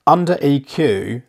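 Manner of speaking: normal talking voice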